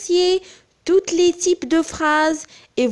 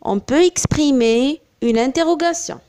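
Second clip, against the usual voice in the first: ordinary talk, nothing marked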